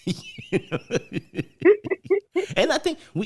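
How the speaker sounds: breaking into high laughter; laughing. Real, not acted. Remembering pranks on management with a colleague, laughing hard. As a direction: laugh through the words, pitch jumping up.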